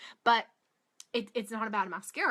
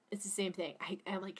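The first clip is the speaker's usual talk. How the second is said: ordinary talk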